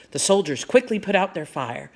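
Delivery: plain reading voice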